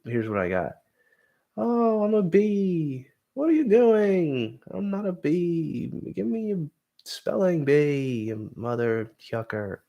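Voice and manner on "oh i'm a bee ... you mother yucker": much higher, slow, stretched Seinfeld bit; high, drawn-out Seinfeld voice, admittedly awful; Jerry Seinfeld impression. Streamer tries Seinfeld for chat, high and slow. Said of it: "I know that was awful".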